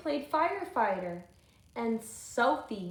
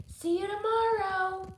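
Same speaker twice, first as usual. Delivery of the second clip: a higher, sing-song taunt; taunting Wendell; 9 semitones higher, 3 dB louder than usual. Acted. Wendell's note teasing Sophie, lightly sing-song. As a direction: tease her with the note.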